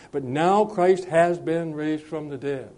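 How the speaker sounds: slow, weighty declaration with stress on "now"; slowed emphatic quote of Paul; emphatic statement. Shown as a demonstration. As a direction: lay each word down deliberately. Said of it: "he makes that emphatic statement".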